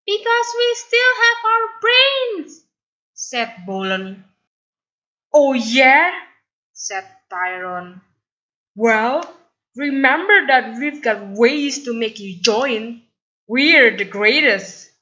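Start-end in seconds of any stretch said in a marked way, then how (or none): 0.1-2.6 much higher, loud defiant voice; defiant retort. Acted. 5.3-6.1 loud, higher scoffing challenge; challenging sneer. Acted. Tyron challenging back. 8.7-14.9 boastful bully. Acted. Tyron threatening and boasting.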